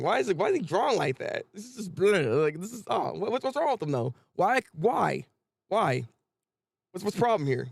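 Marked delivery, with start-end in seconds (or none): none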